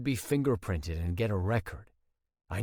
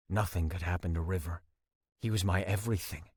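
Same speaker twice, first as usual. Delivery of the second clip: usual speaking voice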